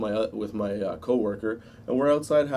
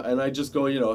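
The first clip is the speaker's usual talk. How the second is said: usual speaking voice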